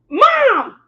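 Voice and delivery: high, loud screaming snap at her mother; a daughter losing her temper and screaming at her mother; furious shout at mom. Retold or imitated. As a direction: yell it like you've finally lost it. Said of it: "And I just lost it".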